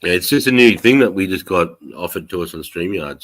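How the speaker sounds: usual speaking voice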